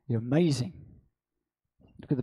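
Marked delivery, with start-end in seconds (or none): none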